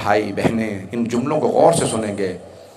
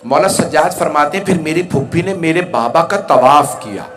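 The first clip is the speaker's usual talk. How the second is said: loud, raised, forceful majlis oratory; impassioned lament preaching; 5 semitones higher, 7 dB louder than usual. Acted. Preacher recounting the martyr's wounds to mourners, loud impassioned delivery. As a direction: drive the grief out to the whole congregation.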